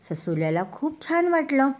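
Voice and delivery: ordinary delivery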